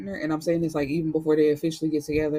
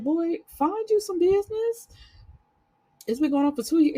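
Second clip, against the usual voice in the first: put-on much higher old-lady voice, brushing him off; sassy grandma; 12 semitones higher than usual. Grandma telling her grandson to mind his business, sassy. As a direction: shoo the nosy grandson away. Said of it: "grandma was like".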